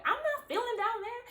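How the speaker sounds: high mock mom voice refusing. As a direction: squeak out the mom's no way.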